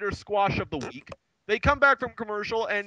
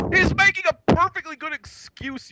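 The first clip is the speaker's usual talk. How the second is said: mock indignation; 11 semitones higher, 5 dB louder than usual. Mock outrage at commentator.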